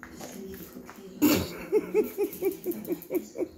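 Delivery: airy, voiceless giggle; breathless laugh. Real, not acted. Owner amused by her dog and cat, airy giggle.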